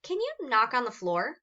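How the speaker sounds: bright, eager call to play; excited invitation. A narrator's reading. Narrator proposing a game to Emma, eager. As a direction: pitch it up like a kid starting a game.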